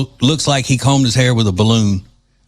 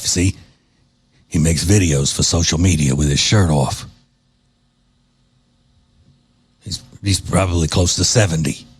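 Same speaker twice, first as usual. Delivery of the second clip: mock mafia don; 7 semitones lower than usual. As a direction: menace him like an old don at the dinner table.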